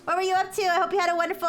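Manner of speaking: high, bright cute greeting voice; cute anime streamer. Real, not acted. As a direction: squeal hellos to the raiders.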